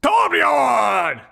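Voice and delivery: announcing the hero's name loudly; shouted name. Acted. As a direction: belt the name out. Calling out a name.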